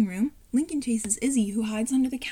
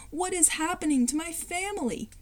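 dismayed cry; 6 semitones higher than usual. Acted. Lincoln dismayed at family.

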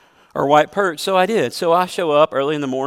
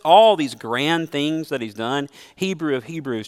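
ordinary talk

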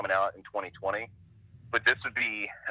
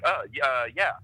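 louder, higher flustered stammer; startled; 4 semitones higher, 5 dB louder than usual. Retold or imitated. Cartoonist caught sketching by a stranger, startled. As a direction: re-enact being caught off guard, stumbling over the reply. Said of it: "I was, like, startled".

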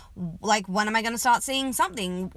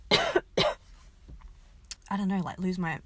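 while sipping a drink; 2 semitones lower, 6 dB quieter than usual. Real, not acted. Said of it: "let me have a sip of coffee".

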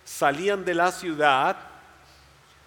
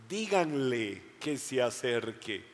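gentle command; 5 semitones lower, 6 dB quieter, slower than usual. Acted. Jesus voiced gently.

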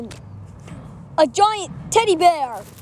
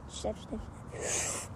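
whispering; 4 semitones lower, 16 dB quieter than usual. Acted. Tiptoeing away from machine.

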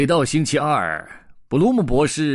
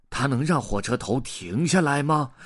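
ordinary delivery, nothing marked

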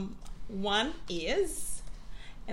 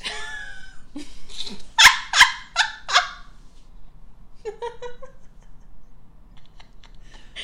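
loud laughter; 6 dB louder than usual. Real, not acted. Big punchline reaction.

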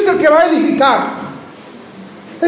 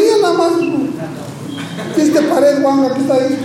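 mocking laugh; faster than usual. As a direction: laugh at the failed builder. People mocking unfinished building.

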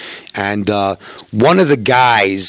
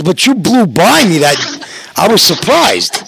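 surprised cop; 7 semitones higher than usual. Retold or imitated. Cop voiced in a retold stop.

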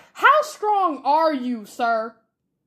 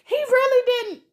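high, loud, incredulous insistence; worked-up and much higher; emphatic agreement; 13 semitones higher, 5 dB louder than usual. Real, not acted. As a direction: agree at a high, exasperated pitch. Heated agreement in a rant.

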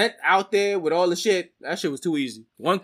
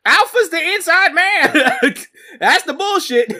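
laughing outburst; 8 semitones higher, 4 dB louder than usual. Real, not acted. Co-host seizing on the inside man theory, laughing outburst. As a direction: crow it out through laughter.

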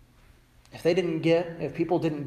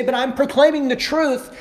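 mock protest; 9 semitones higher, 6 dB louder than usual. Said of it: "you say".

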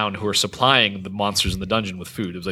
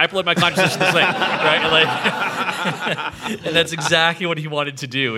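words bubbling with laughter, quick and high; giggling as he tells it; laughing; 7 semitones higher than usual. Real, not acted. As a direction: laugh through the story of the upload. Laughing while retelling own move.

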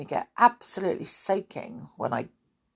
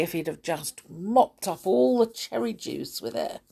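ordinary delivery, nothing marked

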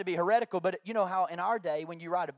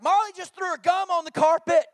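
higher, louder tattletale voice; tattling little brother; 10 semitones higher, 12 dB louder than usual. Retold or imitated. Brother tattling to dad about sister's gum, lightly voiced. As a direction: tell on your sister gleefully.

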